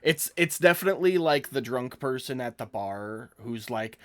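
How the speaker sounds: normal talking voice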